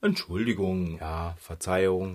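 soft, low, slow sheepish apology; meek apology. Acted. Scolded twins apologise meekly to the cat. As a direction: mumble it like a scolded child.

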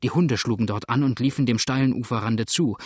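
plain reading voice

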